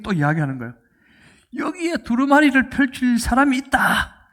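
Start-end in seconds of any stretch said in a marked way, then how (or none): 1.5-4.3 high, loud acted proclamation; elder's shouted announcement. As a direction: cry it out like a herald with news. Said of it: "이렇게 외칩니다".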